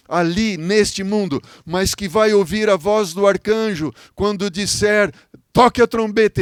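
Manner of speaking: loud, high impassioned preaching; pregação fervorosa. Real, not acted. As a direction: proclaim it to the congregation with rising fervour. Preaches at full force. (pregação inflamada, em voz alta)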